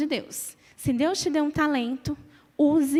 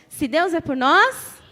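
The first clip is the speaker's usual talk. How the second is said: slow, louder call to the congregation; 3 semitones higher, 7 dB louder, slower than usual. Shown as a demonstration. Preacher asks the congregation to answer louder, slow loud call. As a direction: call it out louder so the internet hears. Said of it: "Se a gente falar um pouquinho mais alto".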